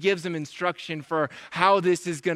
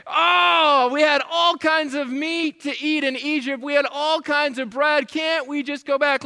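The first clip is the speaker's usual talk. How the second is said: loud moaned "oh", then high complaint; mock crying out; 6 semitones higher, 3 dB louder than usual. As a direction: whine like a people longing for the old days. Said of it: "they start crying out again".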